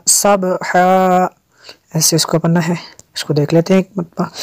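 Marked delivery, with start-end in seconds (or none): 0.1-1.3 slow "सबहा" with a bouncing echo on the b; qalqalah. Shown as a demonstration. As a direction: bounce the b in "सबहा", then hold the final vowel. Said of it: "यहाँ पे कलकला भी होगा".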